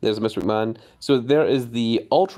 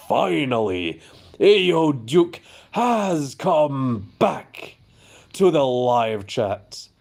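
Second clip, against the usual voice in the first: slow, drawn-out wrestler growl, big on Ayo; hammed-up Rock promo voice, stretching each word; The Rock impression; 3 semitones higher, much slower than usual. Streamer reads chat line in The Rock's voice, hammed up. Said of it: "let me try and do that in The Rock's voice".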